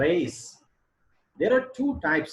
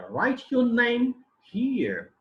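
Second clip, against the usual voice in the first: slow high command, falling on "here"; falling tone demonstration; 12 semitones higher, 4 dB louder, much slower than usual. Shown as a demonstration. Teacher modelling a command for students, high then falling on "here". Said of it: "We use it in commands".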